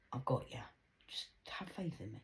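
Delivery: a soft whispered promise from dad; dad's hushed reassurance. Retold or imitated. Her dad whispering he has got her. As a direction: hush it like a secret comfort.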